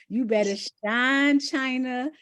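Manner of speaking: high, loud hype for China; excited cheering. Real, not acted. Host hyping up a member on the call, loud excited cheers. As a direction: shout her name up like a cheerleader.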